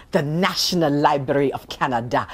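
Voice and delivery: National Library of Canada in a French accent, Canada as /kʰæ̃nədə/ nasal. Shown as a demonstration. Storyteller showing off a little French accent on the library's name. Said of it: "I'm giving it a little French accent there".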